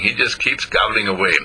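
ordinary delivery

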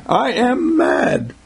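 high, very slow mock declaration of anger. Preacher acting out someone who is mad, mock indignation. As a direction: stretch each word out, pitched high.

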